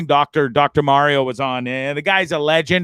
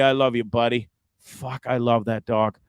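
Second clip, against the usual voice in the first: tearful; 6 semitones lower, 4 dB quieter than usual. Real, not acted. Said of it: "I'm gonna try my best not to cry".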